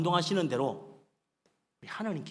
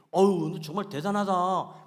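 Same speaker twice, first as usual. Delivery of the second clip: drawn-out, louder gushing praise; mock admiration; 2 semitones higher, 8 dB louder, slower than usual. Preacher imitates listener flattering a boaster, mock admiration. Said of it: "그 소리 듣고 싶어가지고".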